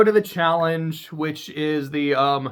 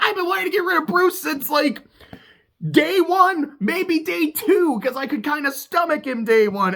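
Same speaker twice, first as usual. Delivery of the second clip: much higher mock voice for Katura; imitating Katura; 11 semitones higher than usual. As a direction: pitch way up to caricature her. Katura quote voiced in recap.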